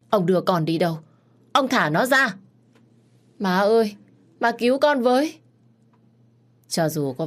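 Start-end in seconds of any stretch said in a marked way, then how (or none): 0.1-5.4 high, loud frantic cries; desperate pleading. A narrator's reading. Mother and son crying out as the boy is locked up. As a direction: scream for help as he is dragged away.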